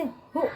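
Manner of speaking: हु as one short syllable; pronunciation demo. Shown as a demonstration. Teacher shows how the matra sounds, हु said short. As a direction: puff out हु with a short u. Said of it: "इस तरह से".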